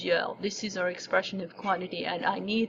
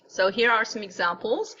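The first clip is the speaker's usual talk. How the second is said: ordinary delivery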